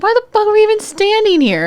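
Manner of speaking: high-pitched incredulous complaint; retold exasperation. Worker stuck talking to annoying lady, mock exasperated. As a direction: squeal it in mock disbelief. Retelling exasperated thought in line.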